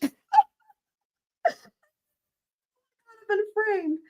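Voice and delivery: giggling through the words; laughing. Real, not acted. Telling the airport story, laughing through it. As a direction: squeak it out between laughs. Laughing while retelling the quote.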